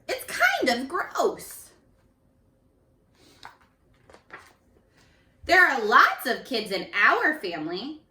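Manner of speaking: lively, slow picture-book read-aloud; reading to children. A narrator's reading. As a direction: read it out to a class of young kids. Teacher reads picture book aloud.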